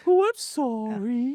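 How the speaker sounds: high, slow embarrassed flirt; awkward flirty voice. Retold or imitated. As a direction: play it like a bad flirt. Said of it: "your woman in Bridesmaids".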